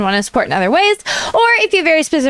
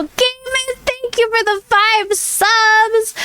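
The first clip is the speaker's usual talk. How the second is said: excited thanks; 9 semitones higher, much slower than usual. Real, not acted. Thanking viewers for gifted subs.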